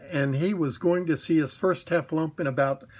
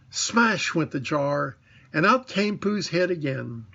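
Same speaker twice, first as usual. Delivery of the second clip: usual speaking voice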